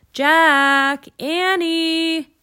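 loud, drawn-out name call; mother calling children from a distance; high, carrying holler; calling from afar. A narrator's reading. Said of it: "a voice called from the distance".

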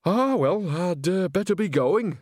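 high, hesitant character voice; nervous excuse. Acted. Nanny backing towards the door from Granny, nervous excuse. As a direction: stall uneasily, pitched well up.

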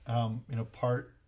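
ordinary talk, nothing marked

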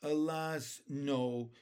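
usual speaking voice

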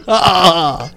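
normal talking voice

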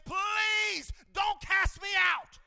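slow, loud, high pleading cry; drawn-out shouted demon plea; begging demon. Acted. Demon begging Jesus in story.

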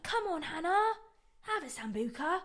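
put-on uncle egging her on in a high, loud English accent; attempted English accent. Uncle urging niece to drink at granddad's ninetieth, attempted English accent. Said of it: "I don't even know if that was an English accent".

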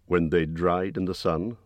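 usual speaking voice

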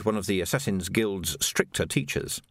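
ordinary delivery, nothing marked

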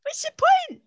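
very high, exasperated mock wail; comic high-pitched outburst; Lorraine meme impression. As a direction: squeal it like the meme. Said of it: "that Lorraine meme".

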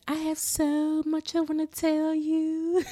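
spooky slow Lisa tease; creepy eager Lisa. Retold or imitated.

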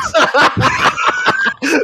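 shrill burst of laughter; high squealing laugh. Real, not acted. Laughs after own joke.

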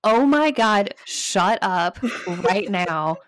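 loud frustrated yell, slowing on shut up; screamed. Retold or imitated. Wife yelling at Siri to stop, frustrated shout. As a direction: bark it at the watch, fed up. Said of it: "And I screamed".

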